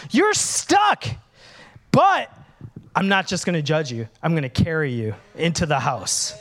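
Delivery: ordinary talk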